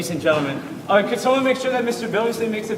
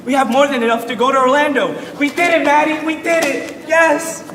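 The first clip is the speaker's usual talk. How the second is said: loud, high, joyful cheering; excited celebration; 5 semitones higher, 5 dB louder than usual. Acted. Teacher celebrating the band trip funds, excited and loud. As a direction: shout the good news in joy.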